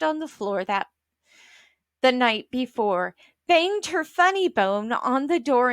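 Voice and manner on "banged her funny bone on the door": usual speaking voice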